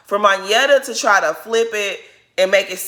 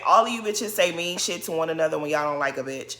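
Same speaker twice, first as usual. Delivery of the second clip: usual speaking voice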